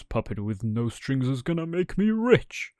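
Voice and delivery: a greedy man crowing over his prize; greedy showman. Acted. Showman gloating over Pinocchio as he cages him.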